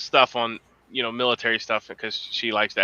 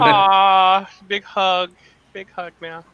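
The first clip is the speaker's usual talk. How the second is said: mock aww; 6 semitones higher, 3 dB louder, much slower than usual. Real, not acted. Mocking sappy sentiment.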